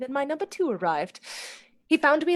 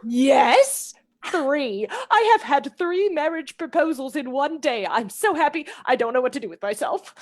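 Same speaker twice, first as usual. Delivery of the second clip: higher, louder, bursting with delight; giddy joy; 5 semitones higher, 5 dB louder than usual. Acted. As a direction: gush with happiness. Lucy overjoyed about three proposals.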